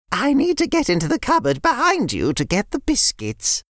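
put-on a fond nan greeting the kids; sweet old nan. Nan voiced.

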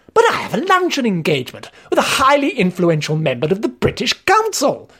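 high-pitched pleading protest; imploring. A narrator's reading. Narrator begging off over a luncheon, imploring. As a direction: plead your way out, voice climbing. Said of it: "I implored".

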